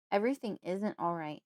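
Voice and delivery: plain reading voice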